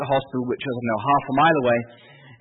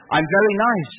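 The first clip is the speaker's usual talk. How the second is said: high, louder imitation of a nurse; coaxing nurse's voice; 7 semitones higher, 5 dB louder than usual. Nurse pitching herself to patient, coaxing imitation. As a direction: sell yourself in a raised, eager voice.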